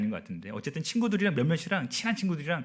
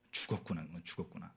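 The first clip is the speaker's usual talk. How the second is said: soft, low mutter to himself; hushed dread; 8 semitones lower, 13 dB quieter than usual. Retold or imitated. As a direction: sink into a resigned inner murmur. Voices his dread walking home.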